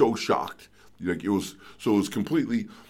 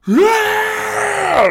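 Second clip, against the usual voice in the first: very high, loud yeah; a trumpeting bellow; elephant roar; 8 dB louder than usual. Retold or imitated. Elephant turning on him, loud roar. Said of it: "he said".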